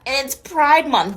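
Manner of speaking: Pride Month dragged out slowly; drawn-out deadpan emphasis; slow, pointed. Real, not acted. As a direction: lay it out slow for effect.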